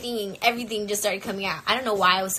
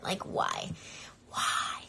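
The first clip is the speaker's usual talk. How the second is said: despairing whisper; 8 dB quieter, much slower than usual. Retold or imitated. Despairing aside mid panic attack.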